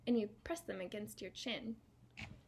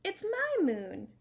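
tree character voice; 8 semitones higher, 8 dB louder, slower than usual. Acted. Tree voiced in picture book.

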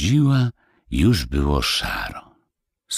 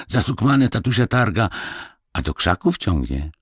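put-on high, lively peasant boy explaining; a small village boy telling a tale; little boy Kuba; 8 semitones higher than usual. A narrator's reading.

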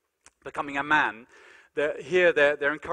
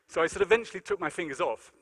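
ordinary delivery

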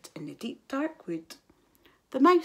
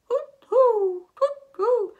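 high hooting; owl hoots; 13 semitones higher, 8 dB louder, slower than usual. A narrator's reading. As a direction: hoot like an owl. Imitated owl hoot.

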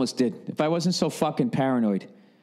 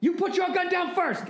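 even higher, more strained mock yell, "your" stressed; mock yell, pushed higher. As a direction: push the standoff line up to a squeal. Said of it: "yelling at the other guy going".

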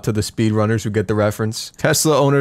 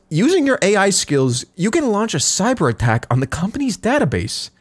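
higher scheming voice; high voiced Velvet; 9 semitones higher than usual. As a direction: instruct it slyly.

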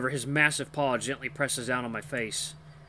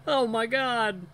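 high, drawn-out exclamation; horrified dismay; 10 semitones higher, 5 dB louder, much slower than usual. A narrator's reading. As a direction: wail slowly in appalled shock. Appalled reaction to the gag.

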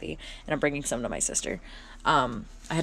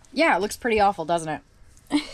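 ordinary talk, nothing marked

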